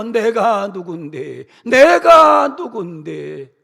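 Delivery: slow, swelling into a loud arrogant shout; mock arrogant yell of who do you think I am; arrogant boast. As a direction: bellow it like a puffed-up big shot.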